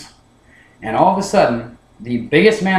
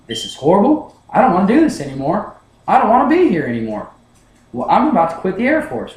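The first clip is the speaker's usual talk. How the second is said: higher, strained desperation; panicked inner voice; 6 semitones higher, 3 dB louder than usual. Retold or imitated. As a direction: think it in a rising panic, ready to give up. Inner panic while being screamed at.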